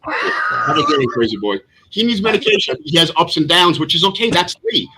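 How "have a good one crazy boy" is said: mocking sendoff. Real, not acted. Sendoff as Lee leaves angry.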